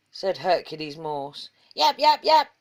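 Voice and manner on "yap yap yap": higher loud yaps; small dog yap. A narrator's reading. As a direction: yap like a scruffy little dog. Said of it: "said Hairy Maclary".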